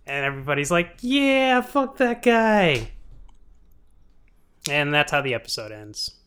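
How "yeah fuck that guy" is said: high, slow, dragged-out mock cheer; lightly voiced crowd agreeing, high and slow. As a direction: drawl it out like a smug crowd. Crowd quoted in retelling.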